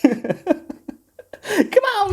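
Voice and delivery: very high, squealing comic impression; hammed-up high-pitched pleading; Chris Tucker. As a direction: whine it out in a piercing squeak. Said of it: "Is it Tom Hanks or Chris Tucker?".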